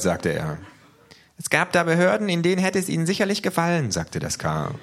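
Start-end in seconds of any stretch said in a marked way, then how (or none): 1.4-3.9 very high, squeaky-pitched character voice, insistent; K, small creature voice. A narrator's reading. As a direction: play K high above your own voice. (sehr hohe Figurenstimme, eifrig)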